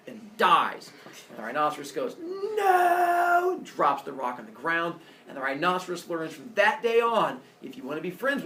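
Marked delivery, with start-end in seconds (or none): 2.2-3.5 high, loud mock wail; hammed-up cry of regret; anguished no. Rhino crying out over the dead monkey, hammed-up wail.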